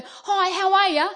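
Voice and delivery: high, loud, friendly hello; cheery greeting. Retold or imitated. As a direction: call it out brightly to someone passing. Calling out to neighbours while running.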